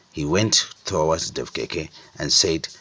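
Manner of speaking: ordinary delivery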